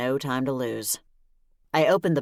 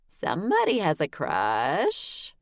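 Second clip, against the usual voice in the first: sing-song; 2 semitones higher, slower than usual. Acted. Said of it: "Sassy said in a sing-song voice".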